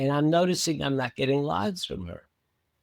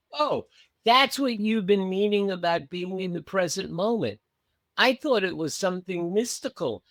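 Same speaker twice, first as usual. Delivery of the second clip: delighted realization; 7 semitones higher than usual. Retold or imitated. Student's delighted realization, retold.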